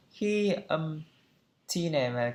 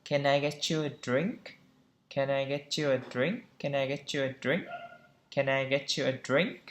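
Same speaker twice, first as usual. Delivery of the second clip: "Can I get you a drink?" rising sharply on drink; linked "Can I get you a drink?" with a final rise; rising question intonation; 5 dB quieter than usual. Shown as a demonstration. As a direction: lift the voice at the end, on "drink". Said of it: "chúng ta lên giọng ở cuối câu".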